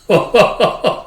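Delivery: high, staccato character laugh; a string of high ha-has; acted haughty laugh. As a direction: act out a mocking laugh. Villain-like laugh after acted taunt.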